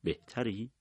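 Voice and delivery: softly, so the others won't hear; a quiet private check-in; hushed. A narrator's reading. Husband asking his sick wife privately, hushed. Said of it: "طوری که بقیه نشنوند گفتم".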